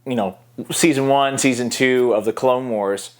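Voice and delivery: ordinary delivery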